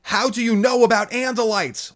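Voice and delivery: high, loud panicked yell; frantic yell. A narrator's reading. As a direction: shriek the question at her. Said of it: "Lauren yelled".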